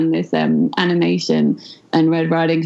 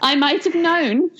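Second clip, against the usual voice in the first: high, indignant pig voice; indignant piggy; 9 semitones higher than usual. Acted. Pig crying out at the wolf, high and indignant. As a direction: cry out at the wolf's trick as the pig. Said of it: "Pig cried".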